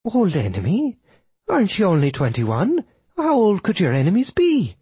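high widow voice with swooping questions; incredulous widow. Acted. Widow interrupts about his old enemy, high and incredulous. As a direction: interrupt in high-pitched disbelief.

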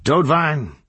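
urgent call of a name. A narrator's reading. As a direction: call up the stairs to get attention. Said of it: "leaned and called to them".

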